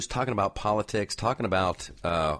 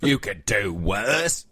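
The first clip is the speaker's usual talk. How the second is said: put-on Australian accent; much slower than usual. Host keeps up his Australian accent.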